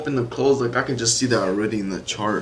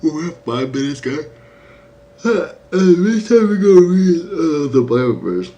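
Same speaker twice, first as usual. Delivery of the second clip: calling out; 8 semitones higher, 10 dB louder than usual. Real, not acted. Calling out to the chat.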